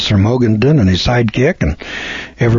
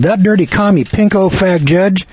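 higher-pitched retold outrage; rabid indignation; 7 semitones higher than usual. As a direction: re-enact being beside yourself with anger. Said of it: "I was just rabid".

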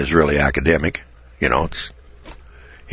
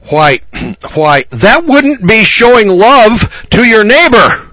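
high, loud, slow indignant preacher; mock-sputter it in a high loud voice; hammed-up flustered preacher protest; blurted out; 8 dB louder, slower than usual. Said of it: "he blurted out".

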